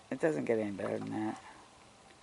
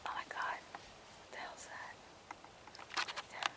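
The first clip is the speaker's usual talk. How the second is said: startled whisper; 11 dB quieter than usual. Real, not acted. Vlogger hearing footprints in the woods, alarmed whisper. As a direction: hush in sudden alarm.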